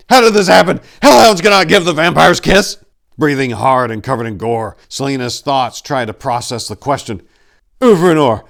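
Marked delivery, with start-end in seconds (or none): 0.1-2.8 high, loud alarmed cry; loud horrified exclamation; exclaimed. A narrator's reading. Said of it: "he exclaimed". 7.8-8.4 loud, high urgent call of the name; breathless call. A narrator's reading. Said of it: "he breathlessly asked".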